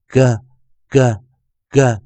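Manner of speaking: "g" repeated as a short hard "guh", spaced out; phonics sound demo. Shown as a demonstration. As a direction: model "g" for learners, one short sound at a time. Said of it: "再听一遍". (示范"g"的短促发音，逐个念)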